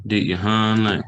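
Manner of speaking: "hun" stressed and stretched into "lay"; emphasis held on U. Shown as a demonstration. Teacher answering yes, real hungry, "hun" held with emphasis. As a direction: lean on "hun" and hold its vowel. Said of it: "you hold emphasis under this U sound".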